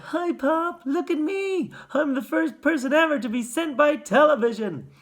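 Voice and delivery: put-on high, excited little boy squeak; proud, piping miniature voice; tiny squeaky voice. A narrator's reading. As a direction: boast like a mouse-sized child. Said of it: "the squeaking of a mouse".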